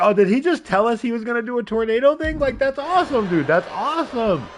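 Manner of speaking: high, gushing amazement; excited fan reaction. Retold or imitated. Streamer reliving his reaction as a fan, high and thrilled. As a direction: gush it fast and high, then exclaim. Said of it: "But I was like".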